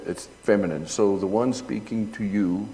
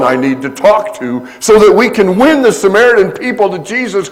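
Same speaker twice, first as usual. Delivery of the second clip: much higher and louder, building fervor; impassioned preaching; 11 semitones higher, 15 dB louder than usual. Acted. Preacher building to the story's climax before the congregation. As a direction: preach it at full force, climbing.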